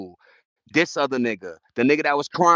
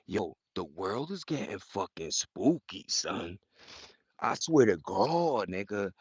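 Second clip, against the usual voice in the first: softer, low, slowed-down warning; hushed and spooky; 5 semitones lower, 7 dB quieter than usual. Real, not acted. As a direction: lean in and hush it like a ghost story. Hushed talk about spooky world.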